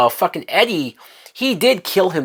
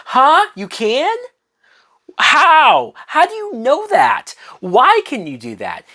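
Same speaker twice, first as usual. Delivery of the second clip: mock disbelief; 10 semitones higher than usual. Reviewer mocking Keon's claim with high incredulous disbelief. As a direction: act stunned, voice jumping high with disbelief.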